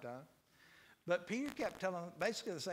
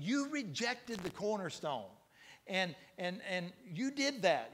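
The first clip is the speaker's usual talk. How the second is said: preaching at full force; 4 semitones higher than usual. Acted. Preacher accusing the crowd who crucified Jesus, building fervor. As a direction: drive every you home harder.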